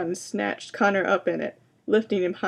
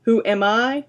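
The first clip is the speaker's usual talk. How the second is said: monster demands it louder; 3 semitones higher, 6 dB louder than usual. A narrator's reading. Monster to Connor, rising to a loud demand. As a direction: push the question out loud, swelling. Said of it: "its voice getting louder".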